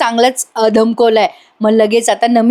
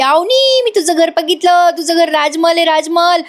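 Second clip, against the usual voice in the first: shouting excitedly; 6 semitones higher than usual. Acted. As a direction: call Avani's name across the house, thrilled. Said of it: "मोठ्याने ओरडत येते नमी".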